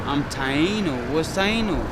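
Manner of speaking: ordinary delivery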